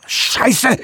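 high yelled curse; bellowing. Acted. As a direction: roar the curse. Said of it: "brüllte Eddie".

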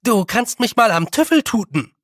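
high, loud cheeky retort; a hint of a sulky girl. A narrator's reading. Stubborn girl talks back.

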